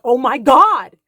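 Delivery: a sudden loud alarmed cry; loud panicked gasp of words; alarmed shout. Retold or imitated. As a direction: shout it as the truck appears. Haru sees the truck coming.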